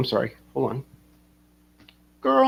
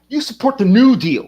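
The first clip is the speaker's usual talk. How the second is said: mock accuser; 8 semitones higher, 5 dB louder than usual. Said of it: "He's all".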